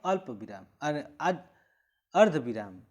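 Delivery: usual speaking voice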